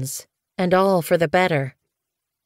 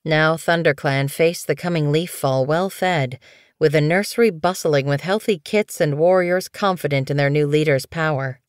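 ordinary delivery

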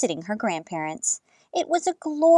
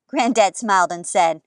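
ordinary delivery

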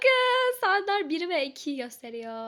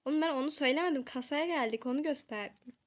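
ordinary talk

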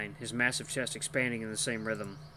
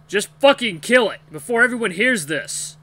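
loud, raised-pitch fury; angry growling; 10 semitones higher, 13 dB louder than usual. A narrator's reading. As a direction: yell an impatient, furious order. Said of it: "hear a deep growl behind him".

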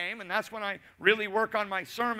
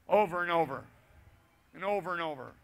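weary echo; 3 semitones lower, faster than usual. Shown as a demonstration. Echoing the repeated stories.

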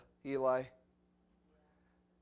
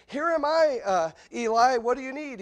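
a hint of an eager young boy, higher; 8 semitones higher, 7 dB louder than usual. Boy Samuel running to Eli, lightly voiced eager call. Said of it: "and he goes".

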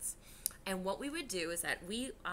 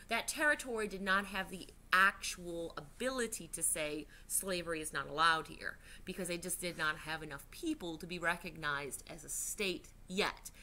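normal talking voice

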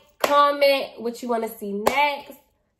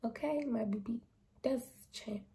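murmured, half-whispered aside; soft aside; 16 dB quieter than usual. Real, not acted. Vlogger softly praising her braces viewers. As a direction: say it softly to yourself.

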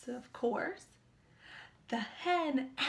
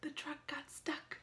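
whisper; 10 dB quieter than usual. Real, not acted. Storyteller hushes suspense.

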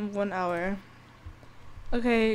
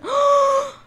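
sharp gasp; 13 semitones higher, 6 dB louder than usual. Real, not acted. Sees Holly's mess.

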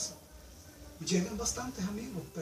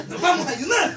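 "Nos vamos a ayunar" said higher and louder, laughing; mock eager quote; 9 semitones higher, 11 dB louder than usual. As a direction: ham up a keen "ayunar" with a laugh. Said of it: "¿Cree usted que dijeron así?".

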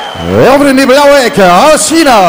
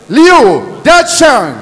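slow, high, drawn-out name call; boxing ring announcer; 13 semitones higher, 8 dB louder, much slower than usual. Acted. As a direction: stretch the name over the crowd. Announcer calls fighter name.

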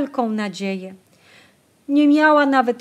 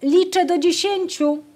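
put-on boy calling out the count, higher; 8 semitones higher, 3 dB louder than usual. A narrator's reading. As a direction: call it out to the other kids. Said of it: "Olek zawołał".

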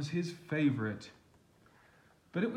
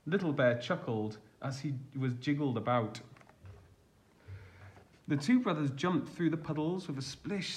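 plain reading voice

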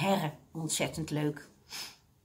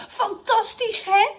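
high, thrilled exclamation with a smile; delighted; 13 semitones higher, 5 dB louder, slower than usual. A narrator's reading. Mrs Mosterd showing off to the seniors, delighted. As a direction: gush with delight. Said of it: "Met een opgetogen glimlach".

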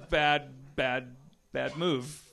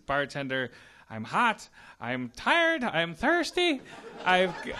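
mock Irish guy; 10 semitones higher, 4 dB louder, slower than usual. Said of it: "the Irish guy says".

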